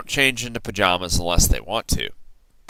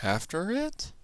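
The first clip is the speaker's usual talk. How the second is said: much higher, much softer whisper; whispered question; 9 semitones higher, 11 dB quieter than usual. A narrator's reading. Crew scared of the shark, soft whisper. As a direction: whisper it nervously. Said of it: "we all whispered".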